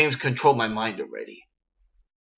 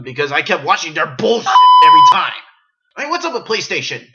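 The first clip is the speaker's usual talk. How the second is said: loud, higher ranting outburst; angry rant; 7 semitones higher, 9 dB louder than usual. Real, not acted. Gamer ranting loudly and angrily about PlayStation. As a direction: blow up at the console brand.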